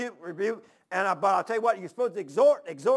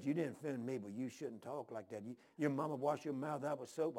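low, hushed, reproving voice; scolding old preacher; 7 semitones lower, 14 dB quieter than usual. Retold or imitated. Old preacher reproving the visitor for his language, quiet scolding. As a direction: reprove softly, well down in pitch.

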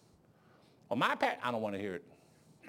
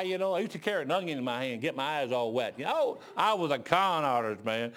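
normal talking voice

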